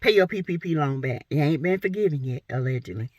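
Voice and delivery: usual speaking voice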